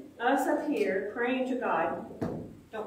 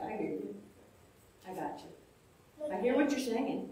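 much softer, gentle promise; God reassuring; 2 semitones lower, 9 dB quieter than usual. Retold or imitated. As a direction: hush it down like a calm reassurance. God reassuring the one praying.

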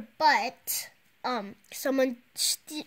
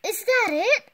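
unimpressed; 9 semitones higher, 5 dB louder than usual. Acted. Unimpressed by apology.

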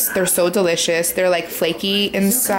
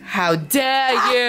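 mock outrage; 3 semitones higher, much slower than usual. Mock outrage, slow and high.

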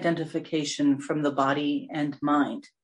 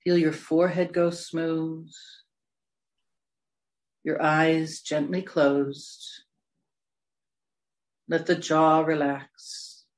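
slow, spacious meditation voice, long pauses between phrases; guided meditation; slower than usual. Real, not acted. Meditation leader guiding listeners through breaths and body relaxation, slow meditation voice. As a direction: draw the words out to guide them into relaxation.

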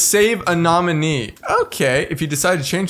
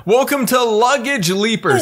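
announcer read; 7 semitones higher, slower than usual. Acted. Reading game title aloud.

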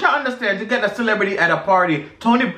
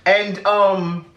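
a light mock British accent, ending lines on yeah?. Telling a friend about Big Brother UK in a mock British accent. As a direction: put on a cheeky UK accent, tag each line with yeah. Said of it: "you don't have to say yeah after every sentence".

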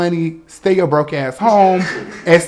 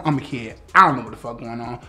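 ordinary talk, nothing marked

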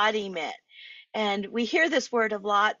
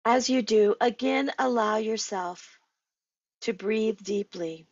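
measured meditation guide with long pauses; guided meditation. Acted. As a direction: lead listeners inward, one unhurried phrase at a time.

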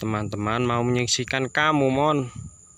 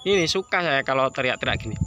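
ordinary delivery

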